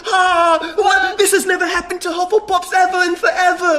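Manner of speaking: high, breathless amazement; hammed-up astonished high-pitched shout; Cedric Diggory. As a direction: exclaim in overjoyed disbelief. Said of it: "Cedric Diggory".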